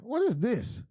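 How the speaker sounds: high, scornful "what is this"; imitating sister. Sister mocking the show's CGI, high scornful imitation. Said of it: "And she's like".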